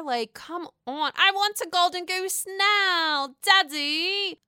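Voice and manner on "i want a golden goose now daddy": high, loud spoiled-brat demand; spoiled Veruca Salt. Retold or imitated. Vlogger mocks Luann as a demanding spoiled child, high and loud. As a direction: whine like a bratty rich kid. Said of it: "Are you Veruca Salt here".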